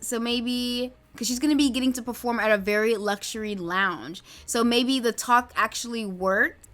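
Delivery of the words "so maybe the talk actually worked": usual speaking voice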